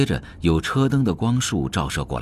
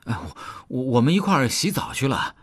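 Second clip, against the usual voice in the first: put-on stammering, higher-pitched young man explaining himself; flustered excuse; 6 semitones higher than usual. A narrator's reading. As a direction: trip over the excuse, pitched up. Youths stammer excuse to scolding woman. (慌忙结巴地辩解)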